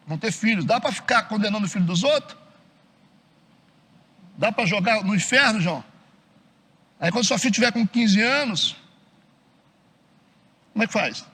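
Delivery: normal talking voice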